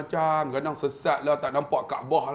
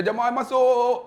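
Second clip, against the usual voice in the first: loud, high call to a group; 9 semitones higher, 6 dB louder, slower than usual. Retold or imitated. Tour guide to his pilgrims at the mosque, calling out.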